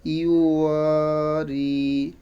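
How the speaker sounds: ইউ ওয়া রি with long ওয়া and long রি; stretched vowels. Shown as a demonstration. Teacher joining the syllables for pupils, slow elongated vowels. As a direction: slowly draw out ওয়া and রি. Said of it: "এটাও একটু কি টান দিয়ে".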